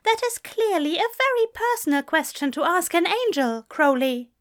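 high-pitched offended primness; affronted. A narrator's reading. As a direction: scold him primly in a high voice.

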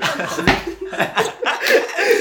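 high rolling laughter. Real, not acted. Laughing at own story.

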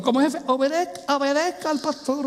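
high-pitched repeated urging; insistent exhortation. Real, not acted. Preacher urging bosses to obey the pastor, insistent.

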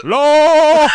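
loud high cackle; laughing. Real, not acted. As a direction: crack up loudly. Cracking up at opera joke.

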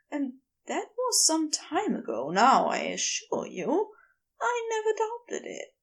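earnest reassurance in a high, light voice; earnest, dismayed gentleman. A narrator's reading.

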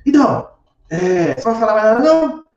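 high-pitched, mildly taunting challenge; aggressive spirit. Acted. Spirit goading the silent doctor through a medium, mock aggressive. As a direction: challenge him, pitch climbing at the end. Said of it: "muito agressiva".

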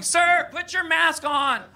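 high drawn-out reprimand from the sand; mock scolding lady. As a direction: play the nagging beach lady, slowly. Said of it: "looking at me going".

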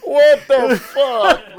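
loud, high, drawn-out disbelief; appalled, incredulous cry; stunned outburst. Real, not acted. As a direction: stretch every word out in loud shock. Reacting to the ash story.

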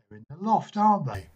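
smirking as he thinks he's caught them; triumphant smirk. A narrator's reading. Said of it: "he demanded, a triumphant smirk".

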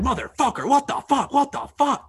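imitating angry cursing. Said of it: "motherfucking himself".

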